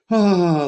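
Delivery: high, loud breathy gasp of awe; mock swooning gasp; girlfriend's gasp. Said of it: "like this".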